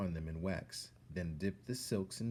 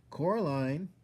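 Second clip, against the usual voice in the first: a loud, high call with a rising end; 9 semitones higher, 7 dB louder than usual. Acted. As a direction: call her name from the next room. Somebody calls her from kitchen.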